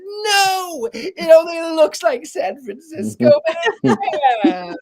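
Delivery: high, loud mock-tearful wail; retold screaming. Woman insisting to her friend during retold trip, wailing in mock panic. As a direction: wail it as if trapped in hell. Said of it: "I was screaming".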